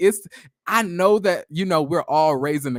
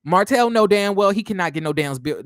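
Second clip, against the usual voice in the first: ordinary delivery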